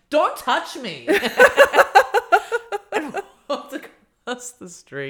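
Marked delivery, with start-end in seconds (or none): 0.1-1.1 firm warning. Retold or imitated. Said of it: "I was like". 1.1-2.9 high burst of laughter; loud laugh. Real, not acted. Retelling the train creep, loud laughing burst.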